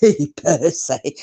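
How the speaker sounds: lightly imitating an old soap gossip; a hint of a Coronation Street busybody calling out; Phyllis Pierce impression. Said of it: "do you remember Phyllis Pierce".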